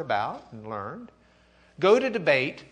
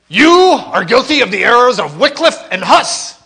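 much louder, higher laughing accusation; accusing shout; 9 semitones higher, 17 dB louder than usual. Pretend. Teacher voicing Eck accusing Luther, loud and laughing. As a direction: hurl the charge at him.

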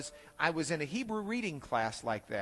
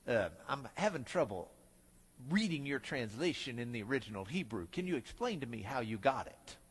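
professor voice; 7 semitones lower, 7 dB quieter than usual. Retold or imitated. Professor questions him in story.

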